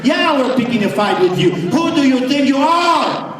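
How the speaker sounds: the church shouting down Goliath; defiant shout. Retold or imitated. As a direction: yell it back at the enemy. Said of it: "the church should says".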